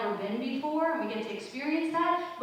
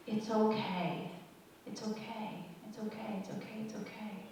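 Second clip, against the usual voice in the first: softer and softer repeated hush; soothing whisper fading out; 4 semitones lower, 11 dB quieter than usual. Acted. The sea soothes the frightened sandcastle, fading whisper. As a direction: lull it down to almost nothing, like a wave receding. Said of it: "Just then the sea whispered".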